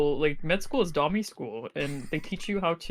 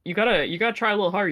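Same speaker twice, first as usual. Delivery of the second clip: ordinary talk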